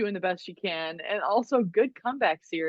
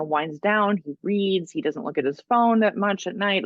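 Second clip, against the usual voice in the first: ordinary delivery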